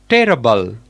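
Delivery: "terrible" as teh-ruh-bul, first syllable stressed; corrected terrible. Shown as a demonstration. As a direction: model "terrible" with weight on teh. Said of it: "It's terrible".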